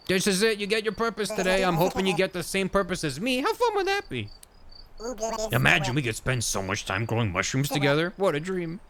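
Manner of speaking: high, excited character voice; giddy hopeful friend. Acted. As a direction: bubble over with hope for a shared dream. Voices a hopeful mushroom grower.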